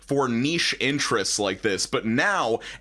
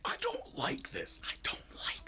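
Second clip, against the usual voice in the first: breathy whisper, quick; 14 dB quieter than usual. Real, not acted. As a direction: whisper it in mock dread. Whispered reaction to the clip.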